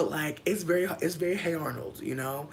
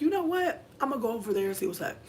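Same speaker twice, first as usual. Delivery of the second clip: excited; 7 semitones higher than usual. Retold or imitated.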